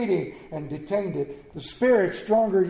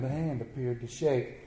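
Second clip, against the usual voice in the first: ordinary delivery, nothing marked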